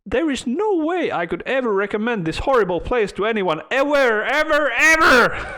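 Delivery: put-on British accent, climbing to an indignant peak; mock-outraged British lady voice; building outraged British lady. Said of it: "in British accent".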